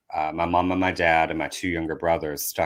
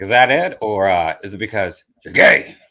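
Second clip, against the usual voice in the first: louder, prodding dad voice; mock suspicious father; pointed question; 2 semitones higher, 7 dB louder than usual. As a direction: press him with the question.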